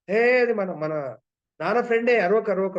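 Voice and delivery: high, loud shout to stop the dog; children shouting at a barking dog, high and loud; calling out. Retold or imitated. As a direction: yell at the dog to hush.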